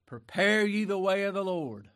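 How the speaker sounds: loud, high ringing scripture quote; preaching. Acted. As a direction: proclaim it to the whole church. Preacher quotes Isaiah in full voice.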